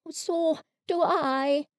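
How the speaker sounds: soft, slow bear reply; lightly voiced Pooh. A narrator's reading. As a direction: answer fondly.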